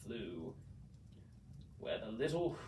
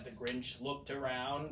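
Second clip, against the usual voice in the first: ordinary delivery, nothing marked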